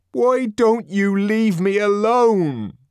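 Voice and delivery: high, slow, pleading outburst; angry but almost begging; plaintive anger. A narrator's reading. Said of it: "an almost plaintive note in his anger".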